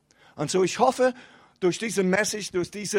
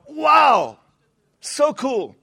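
bright, louder burst of wonder at the start; excited wow; 6 semitones higher, 6 dB louder, much slower than usual. Retold or imitated. Preacher mimicking his own first excitement, loud and high. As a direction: gush like you've just tasted something amazing.

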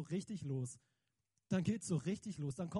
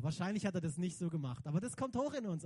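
ordinary talk, nothing marked

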